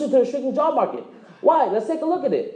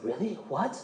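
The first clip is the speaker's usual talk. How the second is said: mock disbelief; 5 semitones lower, 11 dB quieter, slower than usual. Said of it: "He's like".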